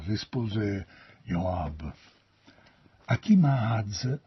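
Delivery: usual speaking voice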